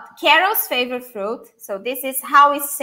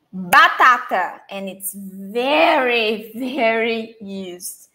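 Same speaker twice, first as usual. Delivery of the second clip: slow; 2 semitones lower, much slower than usual. Shown as a demonstration. Slow teaching emphasis.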